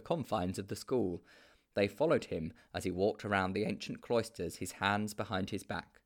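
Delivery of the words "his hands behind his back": ordinary delivery, nothing marked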